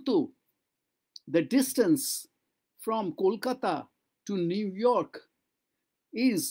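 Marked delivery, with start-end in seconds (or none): none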